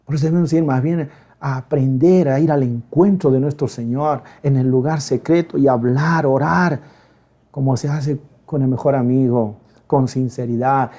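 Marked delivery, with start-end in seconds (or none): none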